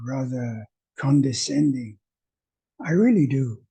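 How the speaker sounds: ordinary talk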